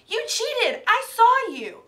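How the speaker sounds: high, loud furious yell; angry yell. Acted. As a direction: yell the accusation at full force. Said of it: "she yelled".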